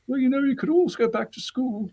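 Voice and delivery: imitating his mother. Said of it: "And it was always like".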